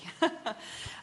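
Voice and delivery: soft, breathy little chuckle; brief self-amused laugh; short laugh. Real, not acted. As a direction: chuckle lightly at your own remark. Laughs at own careful wording.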